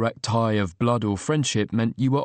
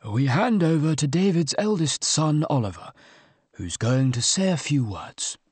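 measured, gentle officiant tone; trained funeral voice; 4 semitones higher than usual. A narrator's reading. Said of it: "his trained funeral voice".

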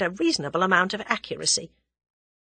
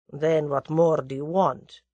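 Russian conspirator voice; 3 semitones lower than usual. A narrator's reading. Russian presses German.

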